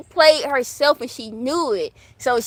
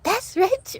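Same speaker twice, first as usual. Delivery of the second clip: imitating Alexis; 9 semitones higher, 3 dB quieter than usual.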